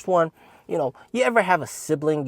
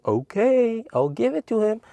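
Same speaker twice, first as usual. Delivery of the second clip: fake-sweet agreeing; 12 semitones higher than usual. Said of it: "And I'm like".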